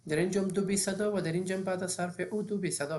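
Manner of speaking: ordinary delivery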